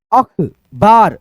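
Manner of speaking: अखबार spoken very slowly, each syllable held; drawn-out syllables. Shown as a demonstration. Teacher slowly sounding out अखबार for students. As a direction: spell out अखबार slowly by ear. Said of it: "सुन लेना अच्छे से ध्वनि".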